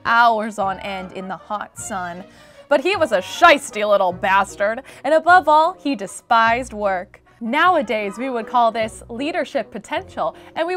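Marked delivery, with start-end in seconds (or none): none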